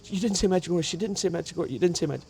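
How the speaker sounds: high-pitched, disbelieving repetition; imitated astonishment. Car buyer hearing him name Medjugorje, astonished. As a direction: imitate her astonished outburst, pitched well up. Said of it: "she went like this".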